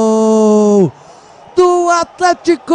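long held goal scream; explosive goal commentary. Real, not acted. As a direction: belt out the goal call.

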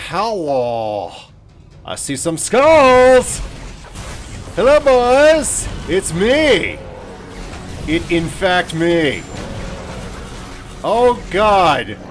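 high, loud greeting to the enemies; loud playful hello; calling out loud. Pretend. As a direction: hail them cheerfully then panic. Calling to skulls then panicking.

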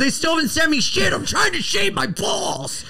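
rising, high-pitched comic rant; mock outrage. Podcaster griping to a sponsor about missing products, mock outrage.

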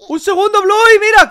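high, loud burst of surprise; sorpresa exclamada. Pretend. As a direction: cry out at a startling discovery. Descubre de pronto otro personaje. (exclamación de asombro, aguda y fuerte)

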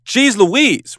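high, loud and slow, fed up with waiting; impatient exasperation. Retold or imitated. A fed-up person at a photo shoot, exasperated. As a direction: groan it out like someone sick of posing. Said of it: "You're like".

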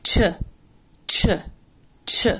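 "تش" as a short lone ch sound, repeated with gaps; isolated CH sound. Shown as a demonstration. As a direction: drill "تش" as separate short sounds. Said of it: "دايمًا بتتنطق". ("تش" صوت قصير منفصل يتكرر)

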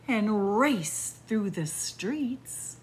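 slow, lilting storybook read; reading to children. Acted. Storyteller reading the windy-day book to kids, lilting. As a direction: savour each image for little listeners.

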